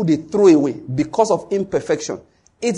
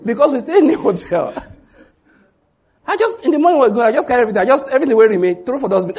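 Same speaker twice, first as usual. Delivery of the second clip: high, louder, giggly storytelling; chuckling, pitched up; laughing while telling; 6 semitones higher, 5 dB louder, faster than usual. Real, not acted. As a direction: tell it through laughter. Laughing retelling of hotel habit.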